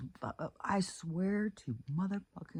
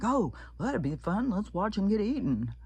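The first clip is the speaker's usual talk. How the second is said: higher, light mocking voice; callous tourist; 7 semitones higher than usual. Imagined tourists eager to watch the seal eaten. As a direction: sound cheerfully heartless. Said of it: "some of those people would have been like".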